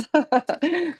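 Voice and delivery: quick high-pitched laugh at the joke; a short, amused, high laugh. Real, not acted. Laughs at the sunny-Miami contrast.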